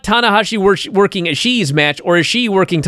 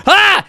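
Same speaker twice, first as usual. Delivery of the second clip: high, loud cartoon shriek; cartoon scream; 13 semitones higher, 5 dB louder than usual. Big cartoon guy fleeing in fright, screaming high and loud. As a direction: scream like a spooked cartoon bully. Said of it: "The guy's like".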